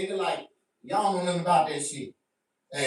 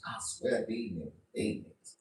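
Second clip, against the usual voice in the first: normal talking voice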